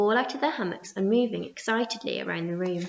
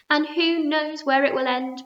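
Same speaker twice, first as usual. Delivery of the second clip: ordinary delivery, nothing marked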